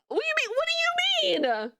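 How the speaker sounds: high-pitched, offended squawk; indignant disbelief. Retold or imitated. Streamer answering Cap's complaint about tone, indignant. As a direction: throw it back in high, mock outrage. Retold indignant reply to Cap.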